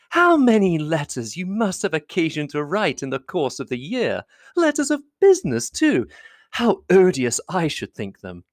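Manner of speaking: high-pitched, fussing society lady; effusive lady admirer, pitched high; gushing flatterer. A narrator's reading. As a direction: fawn over him in a high, swooping voice. Voices Miss Bingley flattering Darcy.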